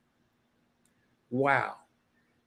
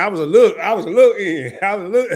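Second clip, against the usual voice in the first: laughing quote; 3 semitones higher, 8 dB louder than usual. Retold or imitated. Quoting Terry, laughing.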